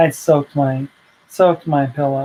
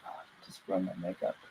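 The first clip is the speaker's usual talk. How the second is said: sarcastic mutter; 9 semitones lower, 19 dB quieter, much faster than usual. Retold or imitated. Grumbling as towel goes on.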